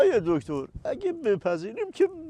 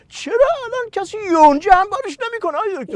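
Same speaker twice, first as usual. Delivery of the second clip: mock complaint; 8 semitones higher, faster than usual.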